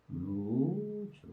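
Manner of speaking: "루즈" softly stretched, very slow; drawn-out sound blending. Shown as a demonstration. Teacher showing how sounds combine in the head, "루즈" drawn out. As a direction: blend the sounds slowly into one long, soft "루즈". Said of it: "합치면 루즈가 되겠구나".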